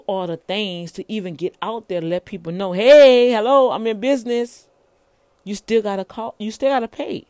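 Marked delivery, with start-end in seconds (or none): 2.7-4.7 loud bright hello to the world; a hammed-up public announcement; calling out. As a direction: shout it out like announcing yourself.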